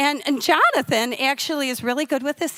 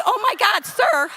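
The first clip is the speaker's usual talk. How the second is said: high, louder exclamation of disbelief; mock indignant shock; 9 semitones higher, 4 dB louder than usual. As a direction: act scandalized at what you heard. Acted shocked reply to the rabbi.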